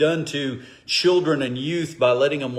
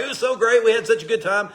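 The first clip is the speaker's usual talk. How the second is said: gushing friends; 8 semitones higher, faster than usual. Retold or imitated. Friends' glowing report retold.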